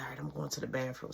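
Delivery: fast, soft whisper; sneaking off whispering; hushed whisper. Retold or imitated. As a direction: whisper it as you slip away.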